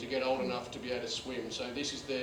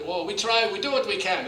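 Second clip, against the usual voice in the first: louder, higher resigned answer; skipper voice; 6 semitones higher, 9 dB louder than usual. Retold or imitated. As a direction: shrug it off loudly. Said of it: "she goes".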